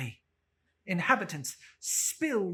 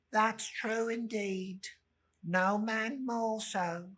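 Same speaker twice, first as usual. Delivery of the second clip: higher, slow, timid agreement; meek laundress; 6 semitones higher, 3 dB louder, slower than usual. Acted. Laundress Mrs Dilber agreeing with the charwoman, timid and slow. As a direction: nervously go along with her.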